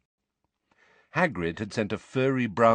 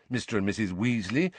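usual speaking voice

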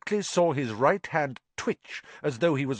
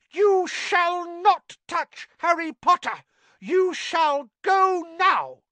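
fierce house elf; 6 dB louder, slower than usual. Acted. Dobby threatening Malfoy.

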